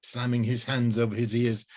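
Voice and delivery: ordinary delivery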